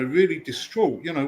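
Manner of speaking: usual speaking voice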